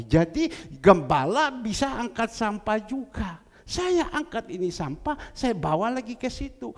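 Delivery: loud, slowed sermon preaching; preaching at full force. Acted. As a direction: raise the voice over the congregation. (khotbah berapi-api, keras dan lambat)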